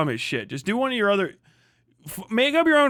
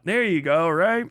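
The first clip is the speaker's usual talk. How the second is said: ordinary talk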